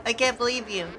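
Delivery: usual speaking voice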